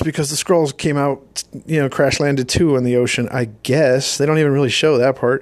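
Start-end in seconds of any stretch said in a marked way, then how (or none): none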